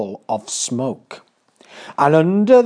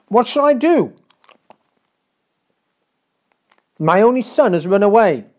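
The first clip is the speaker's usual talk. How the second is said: a higher, louder cry of mock despair as the king; 8 semitones higher, 4 dB louder than usual. A narrator's reading. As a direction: lament the lost son, hands wrung. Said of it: "he wrung his hands in despair".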